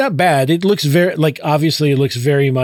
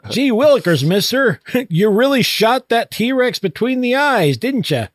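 put-on high, gee-whiz eager kid; wholesome 1950s kid; 8 semitones higher than usual. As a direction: gush like an old-time sci-fi kid. Mocking naive 1950s sci-fi dialogue.

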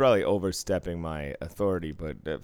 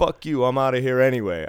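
higher, louder parting curse, imitated; mock furious exit; 7 semitones higher, 10 dB louder than usual. Banned gambler curses the dealer as he storms off, loud and high. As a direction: spit out a retold angry goodbye.

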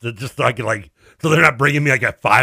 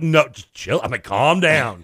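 ordinary delivery

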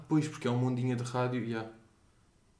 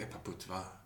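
much softer, offhand bossing of a trainee; mock superior to trainee; 6 semitones lower, 16 dB quieter than usual. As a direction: talk down to the kid quietly and coolly. Mocking a trainee, imagined. (a gozar baixinho com o recruta)

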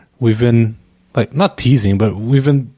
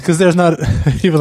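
normal talking voice

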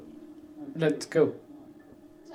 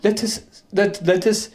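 higher-pitched mock German accent; Werner Herzog impression; 6 semitones higher, slower than usual. Telling his co-host about an episode, German-accented impression. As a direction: imitate a German filmmaker's accented delivery. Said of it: "in the most Werner Herzog way ever".

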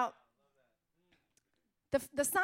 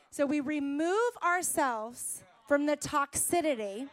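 emphatic preacher delivery, building louder; preaching; 3 semitones higher, 6 dB louder than usual. Real, not acted. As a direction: drive the point home like a sermon. Returns to preaching.

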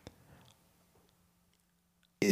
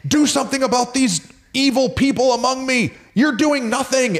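yelling at God; 14 semitones higher than usual. Retold or imitated. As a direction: demand it, pitched way up. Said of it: "He's literally yelling".

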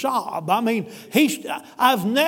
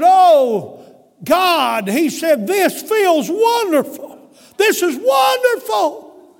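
much higher and louder, slow, joyous cry; ecstatic shout; 8 semitones higher, 7 dB louder, much slower than usual. Retold or imitated. A forgiven man rejoices aloud after weeping at the altar, ecstatic shout. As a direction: shout it with arms thrown up in relief.

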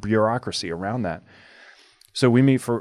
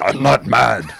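loud, hammed-up German-style anger; comic shouted protest; mock angry denial; 3 semitones higher, 10 dB louder, slower than usual. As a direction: insist it loudly as if furious. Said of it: "Why are you mad".

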